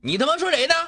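high, loud angry retort; angry challenge. Acted. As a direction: snap back at the insult. Confronting the mocker.

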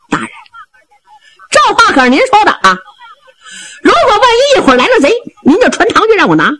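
high, fast, laughing braggart voice; cocky sidekick angling for praise; boastful Zhao Bi. Acted. Zhao Bi making his wager.

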